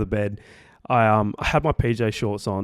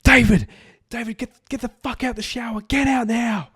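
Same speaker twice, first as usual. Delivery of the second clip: high frantic shout at dad; panicked mum screaming for dad to get out; mum yelling; 12 semitones higher, 4 dB louder than usual. Retold or imitated. Mum yells at dad in the shower, frantic high shout. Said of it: "she's like".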